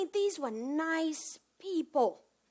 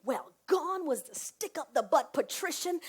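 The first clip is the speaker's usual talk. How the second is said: normal talking voice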